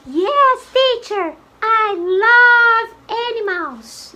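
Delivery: put-on high, slow girl pupil voice; high-pitched, drawn-out little-girl delivery; little girl character. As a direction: answer teacher in a high girlish voice. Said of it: "I am the girl".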